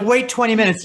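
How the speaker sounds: high, loud mock announcement; mock instruction voice. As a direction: read it like a fussy label. Mocking test instructions.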